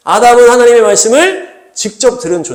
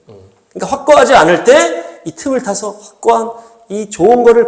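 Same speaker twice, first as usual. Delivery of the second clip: ordinary talk, nothing marked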